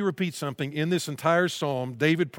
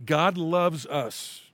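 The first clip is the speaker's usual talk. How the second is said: slow emphatic; much slower than usual. Shown as a demonstration. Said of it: "The truth is this".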